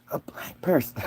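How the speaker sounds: mock her lowering her voice on the words. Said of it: "You notice how she lowers her voice".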